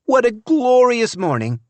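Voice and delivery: wife shouting past her husband; shouting across the car. A narrator's reading. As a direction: call out across the lap. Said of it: "shouting to make herself heard".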